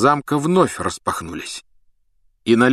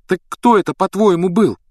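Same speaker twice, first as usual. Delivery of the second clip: high, louder pressing question; insistent demand; 11 semitones higher, 5 dB louder than usual. Acted. Hermione pressing Harry to name him, insistent. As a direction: demand an answer in a raised pitch.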